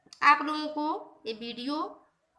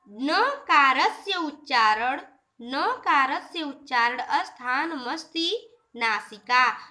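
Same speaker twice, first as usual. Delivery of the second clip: slow, louder chanting of the Sanskrit rule; sutra recitation; 5 dB louder, slower than usual. Shown as a demonstration. Teacher reciting the rule to students, slow and louder. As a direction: intone the sutra slowly for the students.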